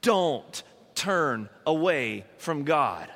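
slow, drawn-out plea with pauses between words; earnest pleading. Acted. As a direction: implore them, stretching the key words. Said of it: "He's pleading with them".

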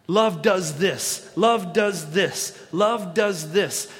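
very slow, every word weighted; emphatic refrain. Acted. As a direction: drive the refrain home slowly. Preacher repeating a key line.

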